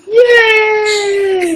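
high squeaky cheer; squealed yay. Retold or imitated. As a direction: squeal it with joy.

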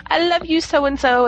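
high sappy love declaration; mock romantic lead. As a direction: swoon it like a cheesy romance. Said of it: "your first character would say".

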